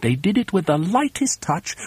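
usual speaking voice

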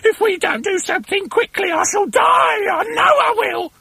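very high, melodramatic shriek; piercing voice of doom; hysterical screaming. A narrator's reading. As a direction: wail it, stretching out the last words. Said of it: "screamed Missus Twit".

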